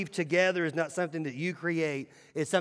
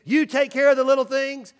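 loud coach chant; coach's shout; 7 semitones higher, 8 dB louder than usual. Retold or imitated. Coach rallying linebackers at halftime, loud chant. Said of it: "He says".

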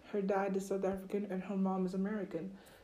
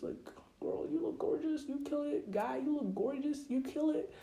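put-on high, bubbly hype-girl praise; hyped-up compliments; 7 semitones higher than usual. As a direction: gush over her like a best friend. Hyping up a friend.

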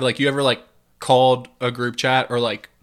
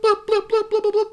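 phone notification bloops. Retold or imitated.

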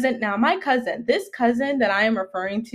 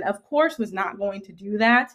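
ordinary delivery

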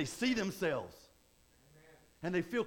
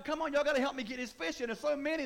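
excited call for help; 7 semitones higher than usual. Retold or imitated. Peter calling James and John to help with the nets, excited. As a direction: yell for help hauling the fish. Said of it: "he started screaming".

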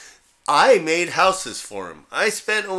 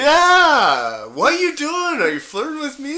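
much higher, coy and laughing; mock flustered jogger; 13 semitones higher, 6 dB louder than usual. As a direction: play shocked at being flirted with. Jogger teasing the coyote.

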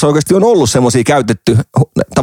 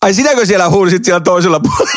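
higher-pitched mock disbelief; incredulous retold question, pitched up; retold astonished realisation; 6 semitones higher than usual. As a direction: act out catching your rival bidder. Retold discovery of rival bidder.

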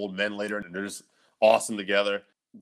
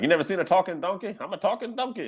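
higher, fast cartoon donkey voice; donkey character; 8 semitones higher than usual. Retelling a Shrek scene to his co-host, lightly voiced cartoon donkey. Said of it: "they're like".

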